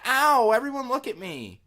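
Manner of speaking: high, loud hammed-up whine; mock attention seeker. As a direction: play a fake-fallen attention seeker, way up and loud. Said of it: "so I can be like".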